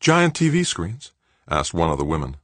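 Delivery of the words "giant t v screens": high, slow, questioning woman's voice; incredulous high-pitched query; woman board member. A narrator's reading. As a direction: echo it slowly in a higher voice.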